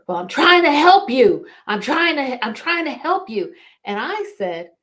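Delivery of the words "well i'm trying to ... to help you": raised, higher voice imitating an exasperated brother; retold yelling. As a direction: shout it back as the flustered brother trying to help. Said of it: "came over and he was yelling".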